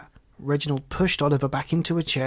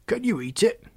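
lightly voiced frog, higher pitch; a hint of a puzzled frog, rising at the end; curious frog; 8 semitones higher than usual. Frog asks Oliver about his cat, curious.

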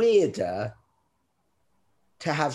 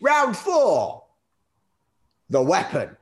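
higher, louder round call; announcer call; 5 semitones higher, 3 dB louder, slower than usual. Acted. Host announces the next round to guests, game-show shout.

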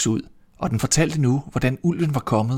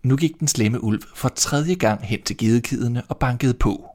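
ordinary delivery

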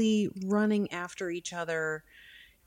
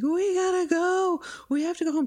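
put-on much higher, panicked little-girl voice; scared daughter screaming; 12 semitones higher, 3 dB louder than usual. Mother imitating her daughter's panic, high frightened child voice. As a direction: squeal it out like a terrified child. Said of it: "And Izzy's just like".

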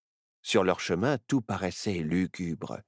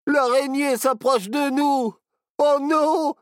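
very high, loud, slowing at the end; terrified shout; 7 dB louder than usual. Acted. Barnabé sees the spider coming closer, terrified shout. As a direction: yell in terror at the approaching spider. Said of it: "hurla Barnabé".

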